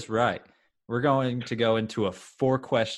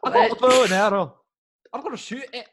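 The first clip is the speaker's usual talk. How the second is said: lightly voiced, higher mock archer; 10 semitones higher, 6 dB louder than usual. Host pretending to be Brave's archer, lightly voiced. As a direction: play the bow-wielding heroine for a laugh.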